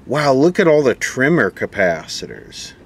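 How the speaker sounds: normal talking voice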